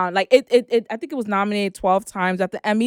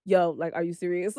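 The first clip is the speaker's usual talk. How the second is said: quiet disbelief; 4 semitones lower, 8 dB quieter than usual. Retold or imitated. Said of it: "she was just like".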